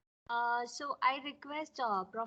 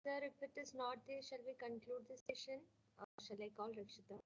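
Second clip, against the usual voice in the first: barely audible; 8 dB quieter than usual. Real, not acted. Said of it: "Like, the voice is very low".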